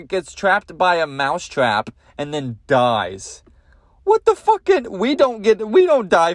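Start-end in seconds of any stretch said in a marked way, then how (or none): 4.0-6.3 high-pitched, incredulous comic outburst; mock outrage. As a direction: jump up high in exasperated disbelief.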